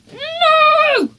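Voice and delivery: very high, loud screamed "No!"; a child's furious scream; Henry screaming refusal at his dad. A narrator's reading. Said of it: "screamed Henry".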